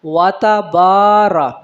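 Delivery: "واتا بارہ" slow, syllables apart, long با; separate syllables. Shown as a demonstration. Teacher models separate letters, slow distinct "واتا بارہ". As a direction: spell out "واتا بارہ" by sound, با held. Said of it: "علیحدہ علیحدہ پڑھا جائے گا".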